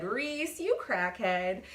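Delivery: high squeal on the name, then drawn-out teasing; mock high-pitched call, sliding down slowly; high-pitched friend impression. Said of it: "high-pitched voice".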